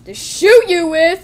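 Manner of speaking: slow, loud, high comeback; play-villain yell; shouted threat. Pretend. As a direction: throw the threat out loud and drawn out. Robber's threat in the story.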